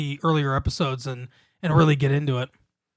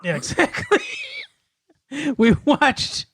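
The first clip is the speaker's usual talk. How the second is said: giggling through the words; laughing; 10 semitones higher than usual. Real, not acted. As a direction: laugh it out, pitch up high.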